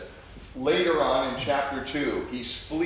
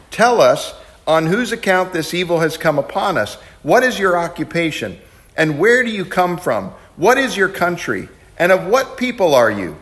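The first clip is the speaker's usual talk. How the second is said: sailors demanding; 4 semitones higher, 12 dB louder than usual. Acted. Sailors interrogating Jonah.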